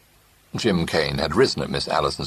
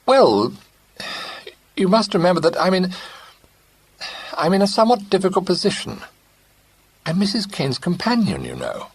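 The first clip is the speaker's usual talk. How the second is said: high, hesitant, broken-up speech; halting; 3 dB louder than usual. A narrator's reading. Companion haltingly explaining her position. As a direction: stumble through the excuse. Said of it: "She said rather haltingly".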